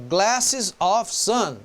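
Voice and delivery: glasses of sun spelled out word by word, slowly; drawn out, each word set apart; slow wrong form. Shown as a demonstration. Teacher slowly sounding out the literal wrong version. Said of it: "se eu fosse colocar com a preposição".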